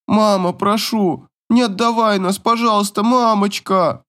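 daughter begging. A narrator's reading. Daughter begs mother to keep them, high desperate plea. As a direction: beg in a high girlish voice.

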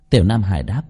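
ordinary delivery

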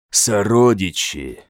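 slow, eerie inhuman call; alien creature voice. Acted. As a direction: summon your kin like a hungry beast. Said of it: "отправило оно немое послание".

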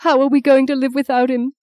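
high-pitched, tearful despair; bitter grief. Acted. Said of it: "She began to sob bitterly".

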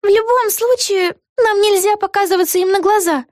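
put-on high-pitched girlish character voice; young heroine voice. A narrator's reading. Vega seeking her friend's agreement, high girlish voice. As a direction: pitch it way up, like a little girl. Vega speaks in narrated story.

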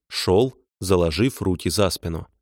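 ordinary delivery

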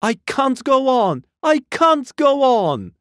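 put-on very high, loud child's wail; weeping child. A narrator's reading. Little girl alone in the fleeing crowd, wailing. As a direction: wail it out in exhausted despair.

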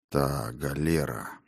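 slow, low and quiet. Acted. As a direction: weigh each word slowly. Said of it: "медленно произнёс Финист".

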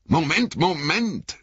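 high, urgent call to halt; shouting. A narrator's reading. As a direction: call out to stop them. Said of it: "schrie Aragorn".

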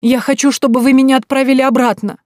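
begging her to send her back; loud, high pleading cry. Acted. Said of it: "взмолилась я".

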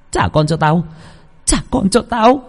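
high, frantic wailing scream. Acted. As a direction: shriek at the dogs for your child. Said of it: "miệng không ngừng la hét". (tiếng thét điên loạn)

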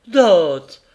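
loud, sharp call of her name; alarmed cry. A narrator's reading. Carrier crying out to his wife when she screams, loud. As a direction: cry out to a wife in sudden fright. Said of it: "cria le voiturier".

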